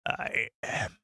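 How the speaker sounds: whispered low dark lord; Senate villain impression. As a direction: breathe it out like the emperor. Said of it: "I'll do the I Am the Senate impression".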